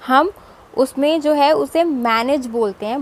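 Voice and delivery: ordinary talk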